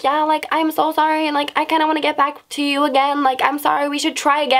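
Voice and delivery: high-pitched imitation of apologizing; mock pleading. Boy crawling back to the girl, mock pleading. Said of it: "tried crawling back to Mama".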